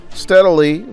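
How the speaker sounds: ordinary delivery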